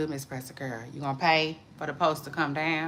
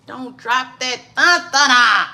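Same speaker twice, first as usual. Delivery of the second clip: louder, higher, slow drawn-out punchline; loud quoted catchphrase; 6 semitones higher, 10 dB louder, slower than usual. Retold or imitated. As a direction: belt out the catchphrase slowly.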